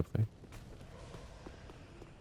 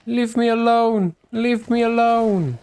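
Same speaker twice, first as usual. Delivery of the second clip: mock pleading; 13 semitones higher, 4 dB louder, much slower than usual. Pleading at in-game enemy.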